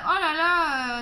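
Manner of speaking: retold loud cry of concern; mock dismay. Chiropractor exclaiming over a patient's sore side, mock dismay.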